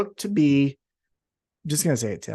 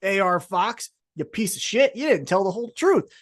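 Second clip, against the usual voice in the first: higher, mock-furious rant at a rival; mock angry rant; 7 semitones higher than usual. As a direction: rant like a riled-up wrestler on the mic, pitched up. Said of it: "And then Darby Allin goes".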